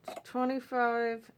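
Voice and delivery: talking around a needle held in the lips; slow, mumbled through a needle in mouth. Real, not acted. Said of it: "I have a needle in my mouth".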